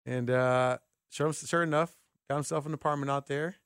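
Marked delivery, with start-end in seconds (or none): none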